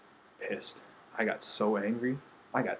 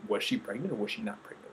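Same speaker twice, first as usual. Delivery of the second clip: ordinary delivery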